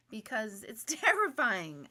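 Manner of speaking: usual speaking voice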